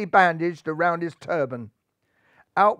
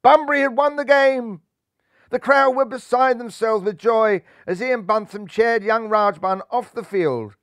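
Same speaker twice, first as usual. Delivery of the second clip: high, louder joyful announcement; triumphant; 5 semitones higher, 5 dB louder than usual. A narrator's reading. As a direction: burst out with the win. Winning moment of match.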